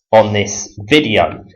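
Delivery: ordinary talk, nothing marked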